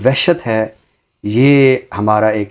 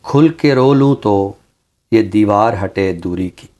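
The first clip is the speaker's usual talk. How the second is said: ghazal recitation. Acted. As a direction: savor each line like a mushaira reading. (धीमी, ठहरी हुई ग़ज़ल-ख़्वानी)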